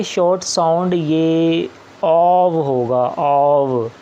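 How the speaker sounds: slow drawn "ऑव" with open aw vowel; लंबा खींचा हुआ "ऑव"; short o sound. Shown as a demonstration. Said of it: "तो इसको ओ नहीं पढ़ेंगे".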